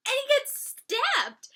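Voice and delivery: high, loud, slow shocked line. Real, not acted. Vlogger recounting Kevin getting stabbed, shocked. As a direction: stress the shock.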